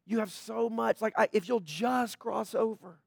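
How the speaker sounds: a hushed, yearning plea; gentle Jesus. Retold or imitated. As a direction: voice Jesus tenderly as if aching for him. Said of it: "I think Jesus was just like".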